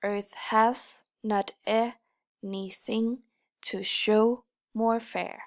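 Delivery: slow, every second syllable stressed; iambic stress. Shown as a demonstration. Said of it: "So, for example".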